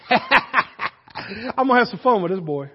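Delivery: slow, laughing through the plan; sly, giggling aside; laughing scheme. Acted. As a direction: chuckle out the prank idea slowly. Boy plans a prank.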